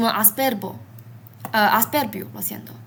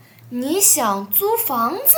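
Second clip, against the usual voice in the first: slow, 你想租房子 ending on a clear rising tone of doubt; slow doubtful rise on 你想租房子; rising question tune; 4 semitones higher than usual. Shown as a demonstration. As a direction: let 你想租房子 climb at the end like a question. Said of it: "usamos un tono de sube".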